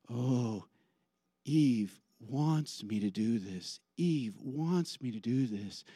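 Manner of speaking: slow, soft and low; subdued, defeated murmur; meek, yielding Adam. Acted. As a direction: give in helplessly, drawing out the words. Acts Adam giving in.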